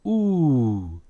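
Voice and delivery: ooh held long and falling; sustained, demonstrative ooh; demonstrated "oo" sound. Shown as a demonstration. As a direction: stretch out the vowel in ooh. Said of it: "the W makes a".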